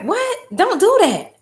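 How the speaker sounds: high startled "What?" then firm; incredulous what. Retold or imitated. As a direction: caught off guard, pitch jumps up. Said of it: "And I'm just like".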